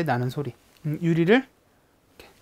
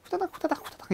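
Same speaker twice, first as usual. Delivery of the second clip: higher, softer quick 후다닥 후다닥; rushing nurse imitation; 10 semitones higher, 8 dB quieter, much faster than usual. Shown as a demonstration. Teacher imitating the school nurse rushing past. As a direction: patter it like a nurse dashing by. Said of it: "엄청 빨리 다녀요".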